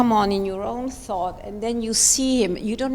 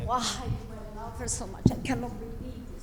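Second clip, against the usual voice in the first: fast breathy whisper of disbelief; hushed disbelief; 8 dB quieter, faster than usual. Shown as a demonstration. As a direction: whisper it quickly, amazed to yourself. Coach voicing dancer's thought.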